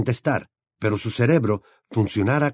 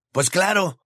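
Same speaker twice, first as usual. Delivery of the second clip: put-on higher teasing boy's voice; higher young wizard voice; Ron's voice; 9 semitones higher than usual. A narrator's reading. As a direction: voice the gangly friend, amused.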